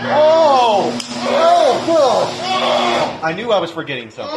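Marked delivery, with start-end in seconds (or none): 0.1-3.2 loud high oh of realization; sudden loud realization; surprised. Real, not acted. Realizes he forgot something.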